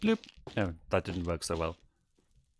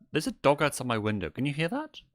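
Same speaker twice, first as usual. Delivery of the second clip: ordinary talk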